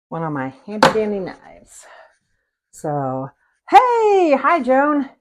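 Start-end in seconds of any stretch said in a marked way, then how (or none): 3.7-5.1 high, loud "hey" stretched out; excited greeting. Real, not acted. Cook spotting Joan joining the stream, excited greeting. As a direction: call out a high, delighted hello. Greeting a viewer who just joined.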